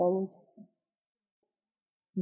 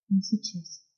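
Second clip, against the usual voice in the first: very quiet; 6 dB quieter than usual. A narrator's reading. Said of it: "произнесла очень тихо".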